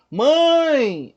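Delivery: "mãe" held very long, high and loud; "mãe" sustained on a high arching pitch; prolonged vowel. Shown as a demonstration. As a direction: stretch the "ã" of "mãe" to show it carries. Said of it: "se você prolongar a palavra".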